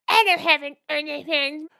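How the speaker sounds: put-on very high, loud girly squeal; screechy falsetto imitation of an indignant girl; mock offended denial. Hot girl denies his guess, mock high squeal.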